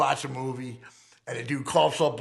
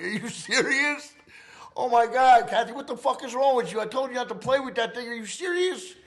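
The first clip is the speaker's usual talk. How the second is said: much higher, indignant, bursting louder at oh my God; shocked disbelief; 11 semitones higher, 4 dB louder than usual. Retold or imitated. Shot man yelling at Kathy in shock, high and indignant. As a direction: let the disbelief spill over at her.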